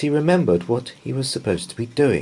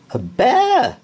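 much higher, louder astonished cry; shocked housekeeper; 7 dB louder than usual. A narrator's reading. Mrs Bird seeing a bear, astonished.

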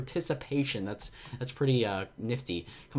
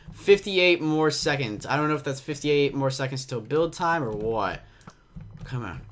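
ordinary talk

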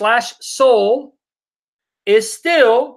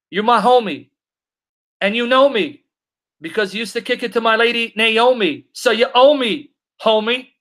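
usual speaking voice

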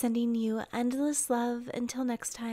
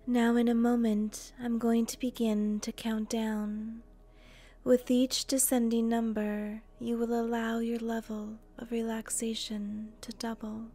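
guided meditation; 4 dB quieter than usual. Acted.

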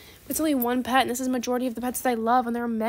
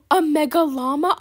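louder, higher, rising excitement; overjoyed exclamation; 4 semitones higher, 7 dB louder than usual. Pretend. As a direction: announce it like a dream prize. Overjoyed at pets in trade offer.

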